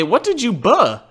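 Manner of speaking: normal talking voice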